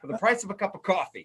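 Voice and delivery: hammed-up pitchman, fast and high; chipper 1950s ad. Hammed-up ad line.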